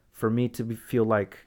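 normal talking voice

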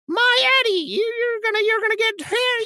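high, fussy mother voice; overbearing mother. Retold or imitated. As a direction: fret like a smothering mom. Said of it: "has the mother who's like".

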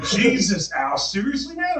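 loud, high mock disbelief; imitated appalled friends. Friends react to the gory killing, loud mock disbelief.

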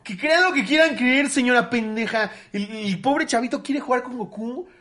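high, loud and slow indignant retort; telling off the grandmother in a store, high, loud and slow; indignant outburst. Retold or imitated. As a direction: fire it back at her, every word stretched out. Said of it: "Y yo".

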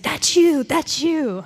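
loud, high, eager nudge; excited prodding. Retold or imitated. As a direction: imitate a keen neighbor egging someone on. Seatmate excitedly nudging the newcomer.

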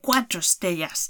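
ordinary talk

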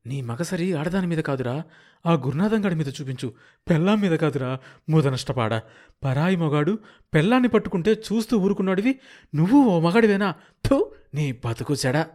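scornful taunt. Acted. Scorning a husband who let another man take his wife, in a loud sneer. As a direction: jeer at him, spitting out contempt.

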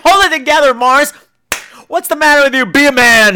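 high, loud mock scolding of a weepy guest; host telling the crying guest to toughen up, loud; mock tough-love scolding. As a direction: bark it like a drill sergeant. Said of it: "And I'm like".

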